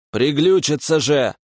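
indignant outburst. Acted. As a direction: blurt it out, indignant and annoyed. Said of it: "возмущался".

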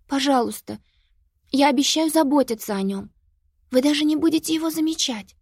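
put-on high-pitched pleading child; pleading girl. A narrator's reading. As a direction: beg like a little girl.

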